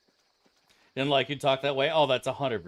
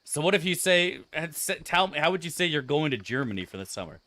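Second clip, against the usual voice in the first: sarcastic, higher and louder comeback; sarcastic mock comeback, raised voice; mocking retort; 6 semitones higher, 4 dB louder than usual. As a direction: needle her with mock questions. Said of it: "I was like".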